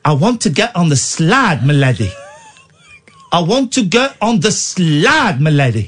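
hammed-up South African vowels; South African accent. Shown as a demonstration. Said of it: "do South African".

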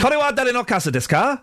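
high, eager Japanese question; showing off Japanese phrase. Shown as a demonstration. Host shows off his Japanese phrase, high and questioning. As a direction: recite the phrase high with a rising end. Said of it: "I was saying that phrase as often as I could".

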